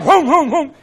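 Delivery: high mimicked huffing; imitated huffing. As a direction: huff in short, high bursts, mimicking an outraged man. Imitates chairman's jumping-up huffing.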